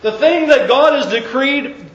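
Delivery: normal talking voice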